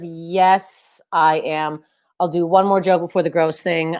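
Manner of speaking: ordinary talk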